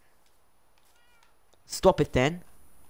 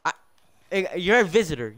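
ordinary talk, nothing marked

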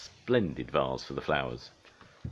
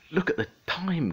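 high-pitched storybook alarm; sudden gasp; 13 semitones higher, 3 dB louder than usual. A narrator's reading. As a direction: gasp as if suddenly realising you're late. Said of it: "gasped Pingu suddenly".

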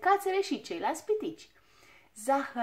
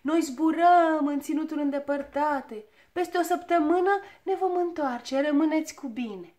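louder, higher farewell call; 4 semitones higher, 6 dB louder than usual. A narrator's reading. Leader calling goodbye from the balloon to the dwarfs, loud. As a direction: call down to the crowd below. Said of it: "strigă el".

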